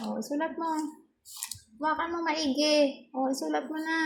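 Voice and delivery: pet-directed coaxing. Pretend. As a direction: coax the puppy as if it could write. Coaxing dog to write attendance. (malambing na pakiusap sa aso)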